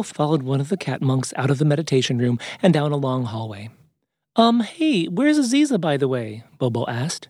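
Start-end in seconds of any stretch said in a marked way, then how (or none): 4.4-6.4 high, tentative question; hesitant, high-pitched storybook bear; curious bear. Acted. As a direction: ask offhand in a high character voice. Bobo asks the monk after Aziza.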